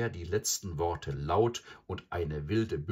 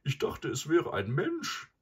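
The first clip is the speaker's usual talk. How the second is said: high-pitched cow character; raised voice of a chewing cow; buffalo cow; 9 semitones higher than usual. A narrator's reading. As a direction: speak as the cow, pitched well up. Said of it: "sagte die Kuh".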